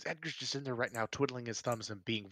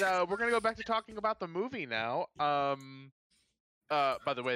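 ordinary talk, nothing marked

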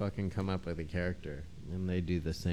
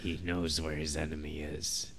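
slow, fading to a whisper; movie trailer suspense, ending hushed; dramatic trailer narration; 5 dB quieter, slower than usual. Acted. S1 parodying Dan Carlin, slow suspense narration fading to whisper.

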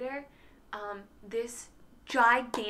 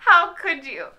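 loud, higher theatrical reproach; mock betrayal; 5 semitones higher, 12 dB louder, slower than usual. Friend reproaching friend over spider, mock betrayal. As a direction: play deeply wounded. Playful accusation echoing her story.

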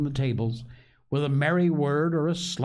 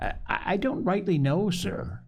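hesitant, higher-pitched stammered answer; nervous stammer; 7 semitones higher than usual. A narrator's reading. Morgan answers the stern landlord, stammering nervously.

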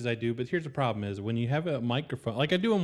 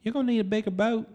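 Southern gentleman voice; 6 semitones higher, faster than usual. Acted. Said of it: "effeminate Southern gentleman's voice".

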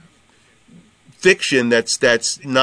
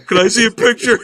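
1 mock creep; 9 semitones higher, 4 dB louder than usual. Mocking a creepy guy's message.

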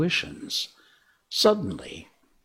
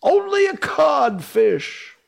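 high, loud, slow jeer; mocking shout; 6 dB louder, slower than usual. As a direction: shout it to mock him. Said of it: "replied the voice".

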